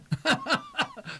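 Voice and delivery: high-pitched burst of laughter. Real, not acted. Host joking with caller about returns, high laugh. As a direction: cackle at your own quip.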